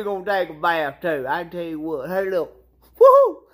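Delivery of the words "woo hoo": high, loud woo-hoo; excited whoop. Real, not acted. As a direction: cheer it out. Cheering, excited outburst.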